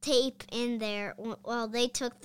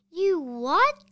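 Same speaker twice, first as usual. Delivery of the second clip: loud shocked yelp, pitched up; shocked cry; 6 semitones higher, 10 dB louder than usual. Acted. Harold learns George lost the manual, shocked loud cry. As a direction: blurt it out in disbelief. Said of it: "cried Harold".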